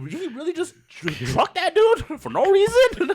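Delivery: high-pitched and breaking into laughter; mock disbelief. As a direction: act out an incredulous onlooker, cracking up by the end. Retold bystander's disbelief, breaking into laughter.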